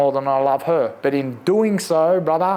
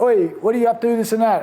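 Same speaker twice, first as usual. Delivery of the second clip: calling out; 7 semitones higher, faster than usual. Retold or imitated. A guy calls out from afar.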